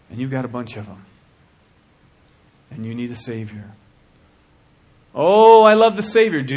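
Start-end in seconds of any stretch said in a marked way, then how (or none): 5.1-6.4 high, loud sing-song of a fake believer; hammed-up pious gush; mock believer. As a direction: gush it mockingly. Mocking lip-service believer.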